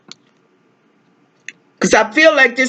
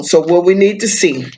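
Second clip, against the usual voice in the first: normal talking voice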